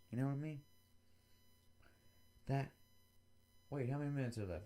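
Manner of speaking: ordinary talk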